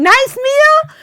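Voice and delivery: very high, loud mock indignation; outraged echo. Wife who cooked for days to husband, outraged. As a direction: shriek his words back in hammed-up disbelief.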